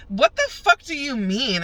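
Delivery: high, loud-edged, drawn-out challenge; indignant disbelief. Retold or imitated. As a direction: throw it back at the cop, high and slow. Incredulous argument with police, retold.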